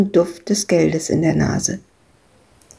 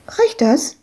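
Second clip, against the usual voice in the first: put-on high, eager child voice; little girl; 9 semitones higher than usual. Little Madita asks Ewke if her money is enough, high child voice. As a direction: ask it like a hopeful little girl.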